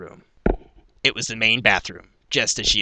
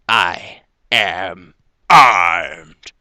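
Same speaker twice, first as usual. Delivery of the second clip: loud, very slow battle cry; triumphant declaration; 3 semitones lower, 9 dB louder, much slower than usual. A narrator's reading. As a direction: stretch each word, building to a roar. Triumphant thought before attacking.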